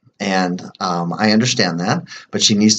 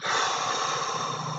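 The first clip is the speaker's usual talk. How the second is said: soft sigh; 13 dB quieter than usual. Real, not acted. Pause between tapping rounds.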